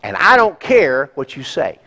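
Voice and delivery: loud, high, defiant selfish voice; mock defiant child demanding its way; selfish attitude. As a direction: play a stubborn "me first" heart. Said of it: "this attitude says".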